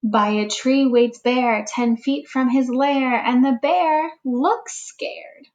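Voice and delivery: put-on slow, expressive read-aloud for young children; storybook reading. A narrator's reading. Teacher reading a picture book to the class, gentle storytelling. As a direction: read it like story time for little ones.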